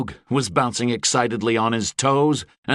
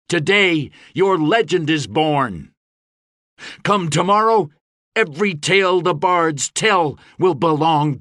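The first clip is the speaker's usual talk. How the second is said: higher, raised battle speech; rousing oratory; 6 semitones higher, 3 dB louder than usual. A narrator's reading. Gabriel rousing bands to save the world, oratory.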